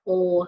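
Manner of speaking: "O" said as a lone dark L, like "ull"; isolated L sound. Shown as a demonstration. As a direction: sound out the final L as "O". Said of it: "one O sound at the end".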